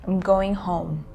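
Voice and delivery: ordinary talk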